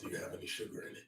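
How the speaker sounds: low gravelly whispered Batman. Pretend. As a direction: growl it like Batman. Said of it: "in the voice of Batman".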